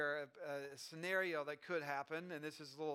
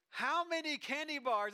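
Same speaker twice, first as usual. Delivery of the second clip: high, loud, drawn-out mock eagerness; eager kid; 7 semitones higher, 5 dB louder than usual. Kid at the candy counter wanting more, eager. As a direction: stretch the question out like a greedy child.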